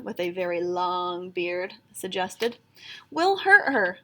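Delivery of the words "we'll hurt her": higher, louder cry of alarm; worried cry. A narrator's reading. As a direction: cry out in worry for the unicorn. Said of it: "cried a fourth leprechaun".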